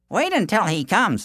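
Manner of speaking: confident villager. Acted. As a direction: reassure the runner. Villagers offer help.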